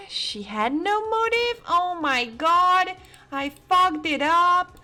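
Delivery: high, very loud, stretched-out shouting; exasperated yelling. Real, not acted. Streamer realizing they messed up the case, real exasperated shout. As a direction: yell it out high, climbing to a shriek.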